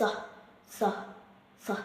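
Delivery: S as a soft unvoiced hiss; hissed phonics S. Shown as a demonstration. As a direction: breathe out a hissing sss. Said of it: "We pronounce it S".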